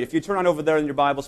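usual speaking voice

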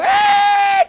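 high yelp of alarm; startled yelp. Retold or imitated. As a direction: yelp as you see the truck ahead. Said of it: "And I go".

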